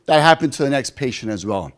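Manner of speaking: ordinary talk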